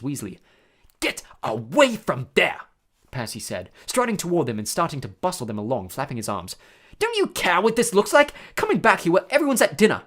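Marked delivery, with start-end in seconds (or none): 1.0-2.6 slow, louder order; scolding command. A narrator's reading. Percy orders them off. 7.0-10.0 loud, high, indignant telling-off; scolding. A narrator's reading. Percy scolds his brother.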